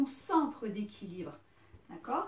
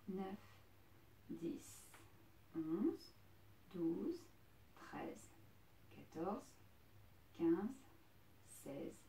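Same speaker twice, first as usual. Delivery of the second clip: softer, steady counting; 4 semitones lower, 12 dB quieter, much slower than usual. Real, not acted. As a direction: count them through the hold. Counting the hold to twenty.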